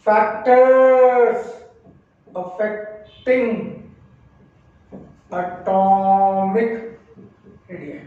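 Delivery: stretched words spaced out; slow dictation. Shown as a demonstration. Teacher to class, dictating the next heading slowly.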